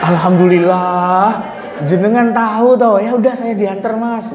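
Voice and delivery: grateful, eager relief at finally getting help; relieved Javanese newcomer. Retold or imitated. The lost Javanese man thanking the ojek driver, delighted. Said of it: "Orang Jawa datang ke Jakarta".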